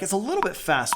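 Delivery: ordinary delivery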